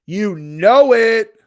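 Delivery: slow, high, drawn-out taunt; loud mock insistence. Streamer needling a chatter, loudly insistent. Escalating repeat of the same phrase.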